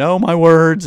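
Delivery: fake-tearful exclamation; mock freaking out. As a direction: parody a drama queen. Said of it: "fake tears and freaking out".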